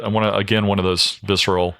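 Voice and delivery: ordinary talk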